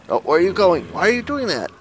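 high, loud incredulous cry; exasperated surprise. Real, not acted. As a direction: react as the zombie runs off. Zombie wanders off.

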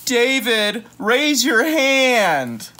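high, loud, slow scolding; teacher's shout. Acted. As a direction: drag out tardy like a cross teacher. Teacher scolding in read book.

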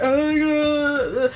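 high, drawn-out pretend bawling; hammed-up sobbing whine; mock wailing. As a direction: wail it as exaggerated fake crying. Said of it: "it's not like I'm crying like".

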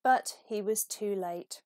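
ordinary delivery

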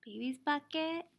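normal talking voice

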